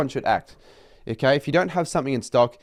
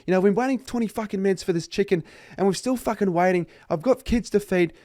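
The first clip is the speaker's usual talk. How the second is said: high, mock-angry complaint at a cashier; imitating abusive customer; 8 semitones higher than usual. Customers abusing an overwhelmed KFC cashier, mock angry rant. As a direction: mimic an entitled customer berating a teen. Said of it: "fucking abusing her, saying".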